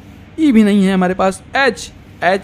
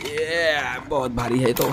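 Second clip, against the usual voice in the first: tired toy truck; 7 semitones lower, 7 dB quieter than usual. Acted. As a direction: lower and softer character voice, slowed down. (थकी हुई भारी गाड़ी की धीमी नीची आवाज़)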